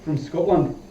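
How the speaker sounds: half-hearted Scottish impression; bad Scottish accent. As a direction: toss it off in fake Scots, under the breath. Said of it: "It's a horrible Scottish accent".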